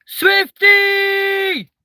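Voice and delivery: high, loud drawn-out call; excited shout. Real, not acted. Streamer hailing a viewer who arrives, excited high shout. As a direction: shriek the nickname in greeting.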